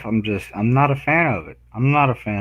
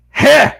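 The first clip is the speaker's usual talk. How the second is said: high, loud "huh" of effort; acted heave noise; 7 dB louder than usual. Showing how the tortoise was moved, acted heave noise. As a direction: imitate a sudden heave.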